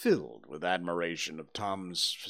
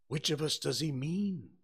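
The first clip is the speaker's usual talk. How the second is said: put-on higher, fearful boy's question; frightened gasp; 7 semitones higher than usual. A narrator's reading. Huck hears the dog howl outside, gasping. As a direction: ask it in a mild fright. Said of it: "gasped Huckleberry".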